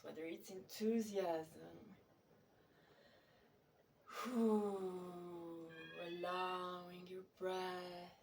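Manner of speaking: slow, stretched words guiding breath and movement; guided meditation. Real, not acted. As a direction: lead the body practice in long, drawn-out phrases. Leads breath and body practice.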